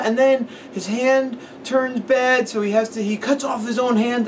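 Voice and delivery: put-on high, slow, earnest little kid. Retelling how the kid described the movie, in a high slow child voice. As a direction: talk like a small boy explaining a movie.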